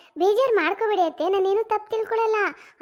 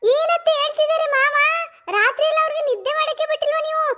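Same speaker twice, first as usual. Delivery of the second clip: higher, louder sing-song tease; gleeful teasing; 6 semitones higher, 3 dB louder than usual. Acted. Daughter-in-law roasting her mother-in-law, higher gleeful tease. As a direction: roast her with mock innocence.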